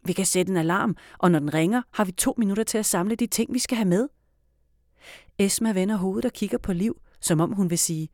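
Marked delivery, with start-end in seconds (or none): none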